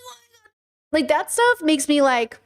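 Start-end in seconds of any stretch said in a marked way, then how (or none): 0.1-0.5 very soft, high mock squeal; mock ugly crying. Streamer overcome by old man's rescue story, mock tearful. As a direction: imitate crying too hard to speak. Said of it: "I was ugly crying".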